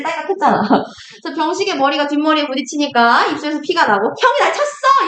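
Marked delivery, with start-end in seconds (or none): 4.2-5.1 high-pitched acted cry of indignation; outraged outcry. Teacher plays younger brother struck by his brother, mock outrage. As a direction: cry out as if just hit, hammed-up. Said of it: "이러면서 막 형을 번쩍 들어가지고".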